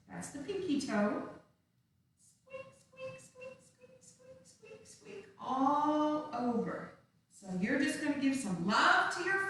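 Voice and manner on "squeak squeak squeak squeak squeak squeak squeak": very high, soft squeaks like a little mouse; tiny mouse squeaks. Shown as a demonstration. Teacher playing the pinky-toe mouse for little dancers, tiny high squeaks. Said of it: "squeaky mouse sound for pinky toe".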